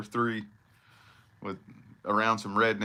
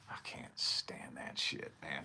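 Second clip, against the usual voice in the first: breathy whispered aside; 9 semitones lower, 14 dB quieter, slower than usual. Real, not acted. Griping about country music, in a whisper. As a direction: hiss it under your breath, disgusted. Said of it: "whispered aside".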